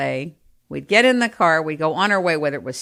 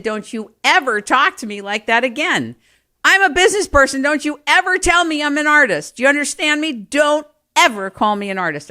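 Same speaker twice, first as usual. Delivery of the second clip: high, loud indignant scolding; mock indignant retort; 9 semitones higher, 5 dB louder than usual. Telling off a friend who called her an artist, loud and indignant. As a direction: bristle at being called an artist. Retold angry retort to compliment.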